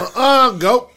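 loud, high scolding refusal; acted-out firm command to a dog; shooing the dog. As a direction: cut the dog off sharply.

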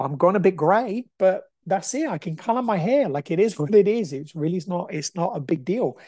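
ordinary talk

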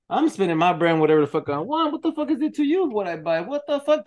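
high, loud imitation of a furious wife; hammed-up angry outburst; mock furious rant. Man voicing his wife ranting about money, angry and high.

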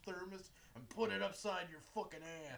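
put-on loud rough kid rant; gruff dock worker hollering. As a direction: rant like a foul-mouthed dockhand. Kid yelling at mom.

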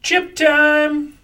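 bright, loud segment call; excited announcement. Real, not acted. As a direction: announce it like a game show host.

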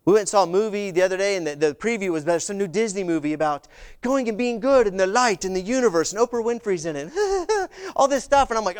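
ordinary talk, nothing marked

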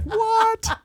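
laughing high-pitched what; stunned what. Retold or imitated. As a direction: squeak it in shock. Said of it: "I'm just like".

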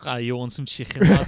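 loud mock Scouse accent; Fabinho's Scouse. Shown as a demonstration. Showing friends how Scouse sounds, mock Scouse. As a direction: do the thick Scouse impression on request. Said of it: "Fabinho's impression of a Scouse".

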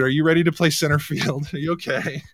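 ordinary talk, nothing marked

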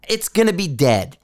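ordinary talk, nothing marked

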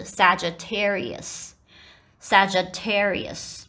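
slow pronunciation demo of Sagittarius; correct Sagittarius. Shown as a demonstration. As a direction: say Sagittarius slowly, g as in "gem". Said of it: "这个G I的部分是变成这".

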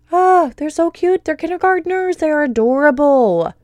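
can't contain how adorable they are; delighted squeal-like praise. Real, not acted. Gushing over kindergartners.

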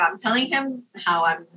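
normal talking voice

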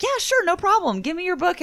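high, eager mock agreement; lightly voiced happy artist; imitating David Finch. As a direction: chirp the offer in a lifted voice. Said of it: "he was like".

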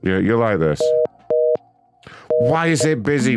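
usual speaking voice

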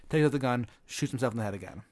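ordinary talk, nothing marked

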